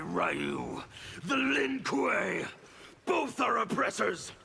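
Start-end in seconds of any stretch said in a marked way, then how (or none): 1.2-4.3 raised, higher angry call; fierce rebel shout. Acted. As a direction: hurl the names out in contempt. Revolutionary rants at rival.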